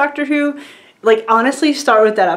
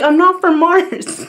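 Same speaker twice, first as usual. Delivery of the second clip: slow, higher British line; "I'm not from Mars" said slowly in a mock British accent, higher; UK accent for the Doctor; 7 semitones higher, slower than usual. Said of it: "they talk in U K accents".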